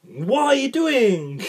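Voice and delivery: a hammed-up Italian accent, slow and high; exasperated mock Italian driver, slow and high. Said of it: "That's my Italian accent".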